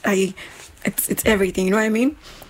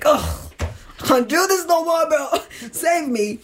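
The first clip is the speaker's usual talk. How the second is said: exasperated; 5 semitones higher than usual. Retold or imitated. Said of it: "I'm like".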